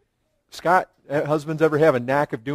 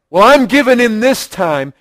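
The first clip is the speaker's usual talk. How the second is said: grudging concession; 5 semitones higher, 7 dB louder than usual. Retold or imitated. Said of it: "it's more like".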